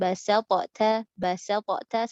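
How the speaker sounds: basalta said wrongly as basatota with a bounce. Shown as a demonstration. Teacher demonstrating the wrong basalta.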